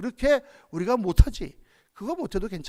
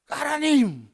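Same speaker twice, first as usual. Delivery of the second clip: higher, louder, tearful calling of God; heartfelt cry to God; 6 semitones higher, 5 dB louder, much slower than usual. Shown as a demonstration. Pastor showing congregation a true inner cry, tearful call.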